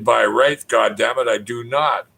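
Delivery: ordinary talk, nothing marked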